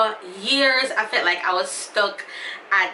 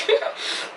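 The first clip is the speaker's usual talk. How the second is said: laughing cry. Real, not acted. Laughing with tears.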